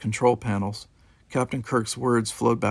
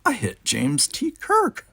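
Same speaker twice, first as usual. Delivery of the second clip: slow words ending in a high squeak on Kirk; dazed, slow, then a squeak on Kirk; 10 semitones higher, slower than usual. A narrator's reading. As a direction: voice cracks up into a squeak of disbelief. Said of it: "something else squeaked out".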